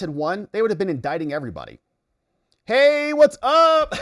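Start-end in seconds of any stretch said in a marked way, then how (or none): 2.7-3.9 much higher, louder, slow stretched hello; drawn-out greeting. Real, not acted. Streamer excitedly greeting a newcomer, slow and loud.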